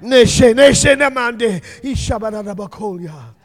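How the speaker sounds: tongues swelling loud then dropping off; tongues prayer. Real, not acted. Preacher praying in tongues over a sister. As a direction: intercede in tongues.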